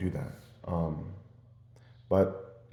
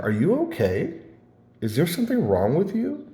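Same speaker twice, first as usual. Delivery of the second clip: higher-pitched, incredulous mock questions; acted concerned questioning; 8 semitones higher than usual. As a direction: imitate a lady checking if he's all right. Lady's alarmed questions, acted out.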